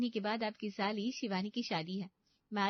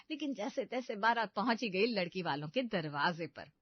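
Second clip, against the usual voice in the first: story narration. A narrator's reading. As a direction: narrator speaking to the audience. Narrator addresses audience.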